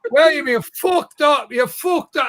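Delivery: loud, mock-angry Scottish accent; comic Scottish-accented telling-off. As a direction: scold in a broad Scottish accent. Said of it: "You're doing Scottish".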